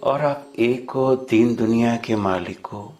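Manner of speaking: ordinary talk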